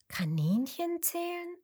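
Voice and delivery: high, puzzled echo; a child doubting the idea; puzzled question. Acted. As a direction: Lisa questions grandpa's odd advice. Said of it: "fragte Lisa".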